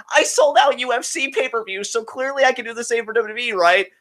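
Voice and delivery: high-pitched mocking voice. Host mocking a fan's claim about pay-per-views, mocking. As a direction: parrot the boast in a high, scornful put-on voice. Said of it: "I kinda mockingly said".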